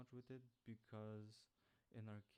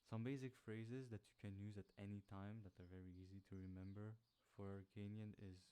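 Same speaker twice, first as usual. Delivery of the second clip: normal talking voice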